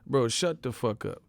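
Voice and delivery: normal talking voice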